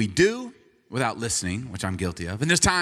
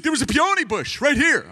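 high-pitched mock wail of protest; upset wife; 12 semitones higher, 4 dB louder than usual. Retold upset wife after scream.

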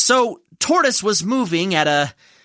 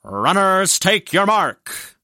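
slow, drawn-out race call; starter's announcement; slower than usual. Acted. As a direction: call the runners to the line, stretching it out. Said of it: "and announced".

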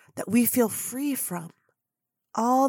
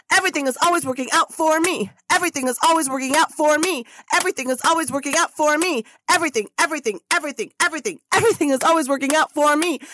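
shouted affirmation; 4 semitones higher, faster than usual. Real, not acted. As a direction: shout it out like a mantra. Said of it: "is what we shout three times".

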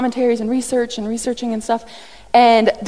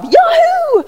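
high, loud gleeful whoop; hammed-up delight, much higher and louder; Pastor Rob cheer; 10 semitones higher, 6 dB louder than usual. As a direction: cheer like the best news ever. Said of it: "in the words of Pastor Rob".

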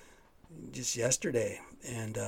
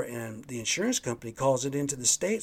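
ordinary talk, nothing marked

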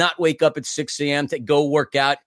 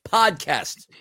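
big, drawn-out podcast intro voice; hyped host welcome; show-opening announcer; 5 semitones higher, 5 dB louder, slower than usual. Acted. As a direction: announce the show loudly, stretching the title.

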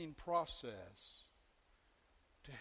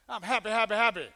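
mock cheerful; 5 semitones higher, 11 dB louder, faster than usual.

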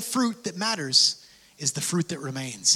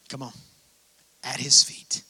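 whisper; 5 semitones lower, 3 dB quieter than usual. Real, not acted.

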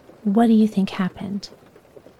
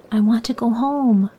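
ordinary delivery

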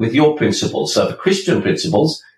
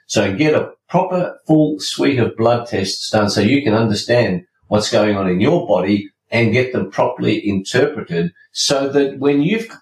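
ordinary talk, nothing marked

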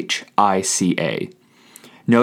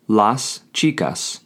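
slowly and clearly: las chicas; slow model pronunciation; slower than usual. Shown as a demonstration. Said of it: "you would say".

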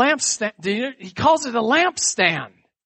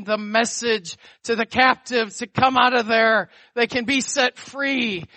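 fervent preaching; 5 semitones higher than usual. Acted. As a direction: preach it out with fire. Preaching at full force.

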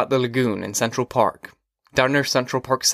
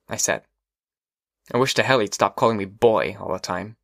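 ordinary delivery

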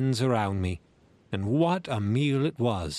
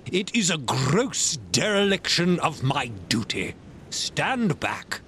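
indignant squire; 4 semitones higher than usual. Acted. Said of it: "cried the squire".

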